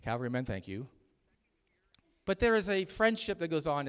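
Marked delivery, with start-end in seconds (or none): 0.0-0.9 quiet thanks. Real, not acted. Quiet repeat.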